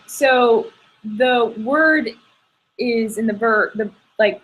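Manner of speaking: ordinary delivery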